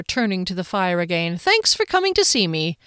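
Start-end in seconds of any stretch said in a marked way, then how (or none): 1.4-2.8 high, falsely bright thanks; insincerely chipper, pitched well up; feigned cheer. A narrator's reading. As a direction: lay on fake cheer. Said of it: "she said with feigned cheer".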